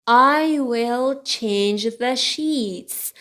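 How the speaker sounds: slow, drawn-out learner's model; slow model reading. Shown as a demonstration. Teacher repeats the books sentence for learners, slowly. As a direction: give each word room, slowly.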